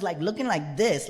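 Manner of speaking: usual speaking voice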